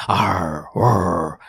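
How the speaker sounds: bear growl. A narrator's reading. A bear growling at the man below. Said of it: "he growled".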